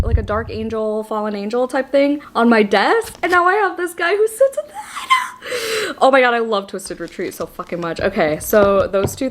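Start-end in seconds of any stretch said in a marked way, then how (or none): 3.2-5.9 excited laughing. Real, not acted.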